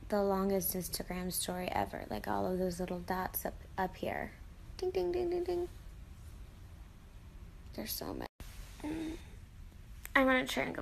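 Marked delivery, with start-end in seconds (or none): 4.8-5.7 high chiming ding ding; sing-song dings. Pretend. Vlogger counting Instagram story dots with high chiming dings. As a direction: chime it out like a bell.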